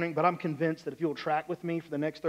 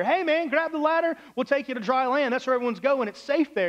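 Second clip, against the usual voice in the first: high, raised rescuer's call; 7 semitones higher, 3 dB louder than usual. Acted. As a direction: shout down to someone you want to save. Helicopter rescuer calls to man.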